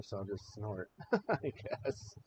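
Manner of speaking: quiet aside breaking into a laugh; embarrassed laugh. Real, not acted. Apologising to viewers for snorting on air, embarrassed laugh. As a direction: laugh it off softly while sniffly. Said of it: "emotional things happening in my head".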